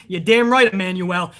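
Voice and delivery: ordinary delivery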